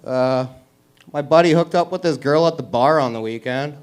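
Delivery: ordinary talk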